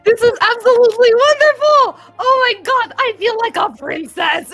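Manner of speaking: high, loud thrilled gush; delighted exclaiming. Acted. Grendel admires her new hairdo, high thrilled gush. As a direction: gush like she's a princess. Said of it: "hear [name] go".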